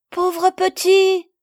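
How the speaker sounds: high, loud, drawn-out cry of dismay; pitying cry. A narrator's reading. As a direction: cry out slowly in pity. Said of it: "s'écrièrent à la fois". (cri de pitié consterné)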